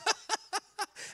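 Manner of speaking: high staccato mock laughter; put-on ha-ha-ha, high and light; imitated laugh. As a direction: imitate a friend bursting out laughing. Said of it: "he starts laughing".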